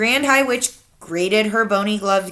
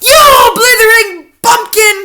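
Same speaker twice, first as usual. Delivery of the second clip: slow, high, loud furious screech; furious witch screech; 14 semitones higher, 12 dB louder, slower than usual. A narrator's reading. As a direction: scold the fool as the witch leader. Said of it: "screeched the Grand High Witch".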